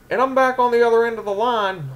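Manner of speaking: high-pitched, worked-up storyteller from Memphis; Honky Tonk Man. Acted. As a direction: play an exasperated Memphis wrestler. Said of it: "Honky Tonk Man's from Memphis".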